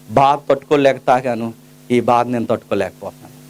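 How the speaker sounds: a hushed, broken plea to be let go; retold tearful plea. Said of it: "ఏడుస్తూ అన్నాడు".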